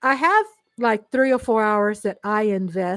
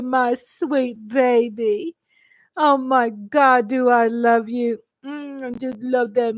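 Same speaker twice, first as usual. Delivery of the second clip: ordinary talk, nothing marked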